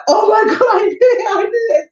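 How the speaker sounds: high, loud, tearful cry of panic; sobbing, shouting through tears. Retold or imitated. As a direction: wail it out like a scared kid in tears. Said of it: "Started crying".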